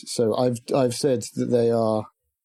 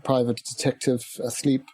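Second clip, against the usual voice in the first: ordinary talk, nothing marked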